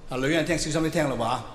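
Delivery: normal talking voice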